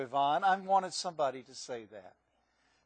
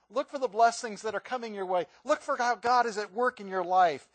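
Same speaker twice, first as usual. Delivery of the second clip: ordinary talk, nothing marked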